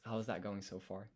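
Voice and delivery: ordinary talk, nothing marked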